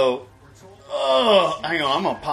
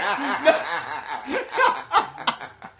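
high wheezing laugh; high cackle; 11 semitones higher than usual. Real, not acted. Cohost cracks up at his own joke.

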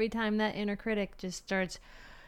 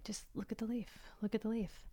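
soft repeated whisper, almost to herself; whispered self-coaching; 10 dB quieter than usual. Retold or imitated. Telling herself to focus on a leaf, hushed whisper. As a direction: coax yourself gently in a hush. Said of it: "but you're like".

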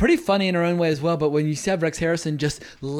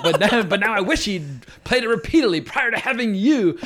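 higher-pitched, very precise cutting retort; malicious, sophisticated English diction; 6 semitones higher, 3 dB louder than usual. Retold or imitated. Husband wishing her father had played repeatedly, elegantly malicious. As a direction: deliver a cultured English insult, every word clear. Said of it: "only the English can be that malicious".

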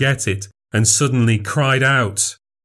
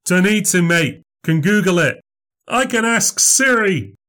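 higher, cocky mocking lads; heckler taunt; 10 semitones higher than usual. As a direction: jeer at him like a smug lad.

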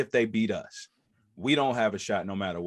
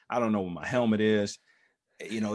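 ordinary delivery

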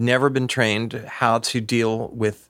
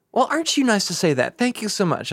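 sweet and gushing, pitched higher; naively gushing thanks; 10 semitones higher than usual. Retold or imitated. Retelling how she thanked the flirting stranger, sweetly gushing. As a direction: play it flattered and innocent. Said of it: "And I said".

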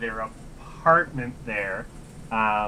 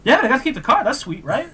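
much higher, delighted and carefree; beaming sidekick voice, pitched way up; grinning Luis voice; 14 semitones higher, 6 dB louder, faster than usual. Retold or imitated. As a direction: sell the bad news as great news. Imitating Luis, happy face.

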